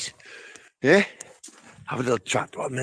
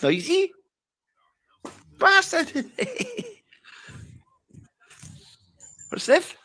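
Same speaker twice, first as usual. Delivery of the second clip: squeaky silly voice. Acted. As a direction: ham it up as a squeaky cheeky lad. Squeaky voice continues.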